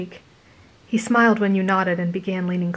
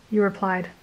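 usual speaking voice

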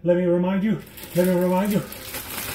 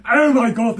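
normal talking voice

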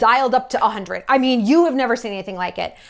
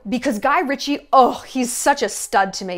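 ordinary delivery

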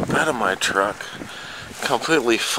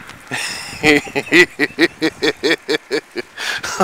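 real laugh. Real, not acted. Stunned by deep snow.

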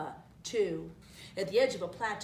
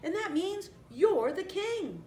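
high, louder excited announcement; exclaiming; 10 semitones higher, 4 dB louder, slower than usual. A narrator's reading. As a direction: proclaim the great news, stretching the end. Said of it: "Nala exclaimed".

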